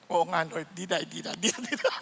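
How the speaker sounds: low, soft, sheepish promise; answering God in a small voice; meek reply. Acted. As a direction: back down quietly. Pastor meekly answers God.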